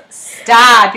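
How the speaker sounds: usual speaking voice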